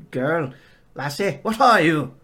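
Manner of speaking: loud, startled Scottish farmer. Acted. Farmer squinting at the girl, loud Scottish accent. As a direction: bark it out in a Scottish brogue. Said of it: "that Scottish accent always wins".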